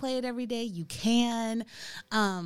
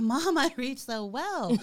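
put-on child voice; 6 semitones higher than usual. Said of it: "He's like".

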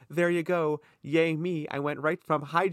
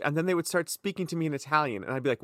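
normal talking voice

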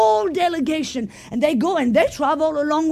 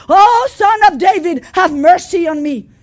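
pleading cry; 2 semitones higher, 7 dB louder than usual. Retold or imitated. Woman begs Jesus.

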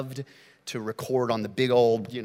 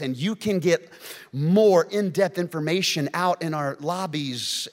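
normal talking voice

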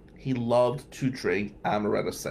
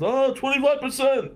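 much higher, louder mock drunk yell of "twenty-five percent"; a drunk friend bragging, hammed up; drunken boast; 14 semitones higher, 4 dB louder than usual. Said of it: "He used to scream it".

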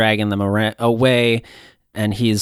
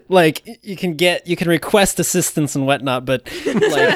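laughing; 6 semitones higher than usual. Real, not acted. Laughing while giving advice.

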